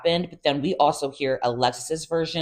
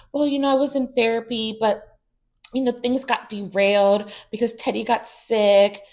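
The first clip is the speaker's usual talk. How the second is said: much higher, whiny mock of Tamra; mock excuse voice; 7 semitones higher than usual. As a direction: parody her excuse in a high whine. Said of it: "uses Teddy again as the excuse".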